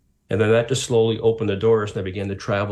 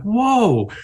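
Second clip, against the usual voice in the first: amazed whoa; 10 semitones higher, 6 dB louder than usual. Retold or imitated. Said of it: "And I'm like".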